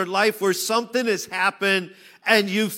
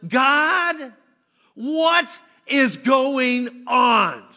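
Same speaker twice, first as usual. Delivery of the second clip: raised, slow pleading cry; anguished cry to God; 4 semitones higher, much slower than usual. Acted. Preacher voicing the exiles crying out to God, pleading. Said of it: "What they were saying was".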